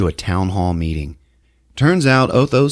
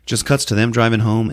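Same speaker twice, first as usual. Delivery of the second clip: usual speaking voice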